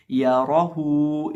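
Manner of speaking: राहू with a full, thick रा and a long हू; full heavy Ra. Shown as a demonstration. Teacher shows pupils the heavy रा in राहू. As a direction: stretch राहू slowly, heavy रा. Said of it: "पुर करेंगे और पढ़ेंगे या राहू".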